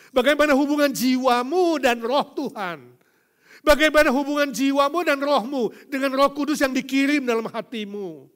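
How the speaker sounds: loud, high preacher's cry; preaching at full force. Acted. Pastor crying out God's power over all to the congregation, loud and high. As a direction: proclaim it to the whole hall.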